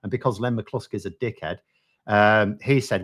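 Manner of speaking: ordinary talk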